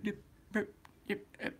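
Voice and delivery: high, soft mumbled yeps like a timid office drone; Milton impression. Teen pranking a drive-thru worker, high, soft mumbled yeps. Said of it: "like, a Milton from Office Space".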